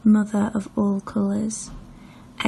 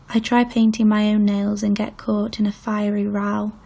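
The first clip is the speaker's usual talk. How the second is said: ordinary delivery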